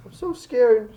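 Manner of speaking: put-on scared young girl clinging for protection; frightened little girl. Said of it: "she's just crying and".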